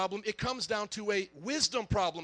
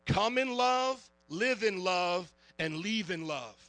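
motto delivery; 3 semitones lower, slower than usual. Shown as a demonstration. Pastor gives the church its motto, slow and measured. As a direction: lay out the motto slowly. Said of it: "You all ready for the motto?".

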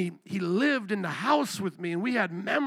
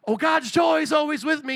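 high, loud gushing piety; exaggerated sing-out cheer, pitched up; mock joyful; 7 semitones higher, 6 dB louder than usual. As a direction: parody someone who is blissfully happy. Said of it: "I don't wanna paint a picture".

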